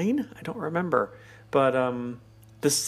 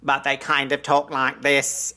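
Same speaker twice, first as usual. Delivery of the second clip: spacey, modulated robot monotone; mock-robotic, evenly stressed delivery; Dalek impression. Shown as a demonstration. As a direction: talk like an alien machine from old sci-fi. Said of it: "modulated to make it sound spacey".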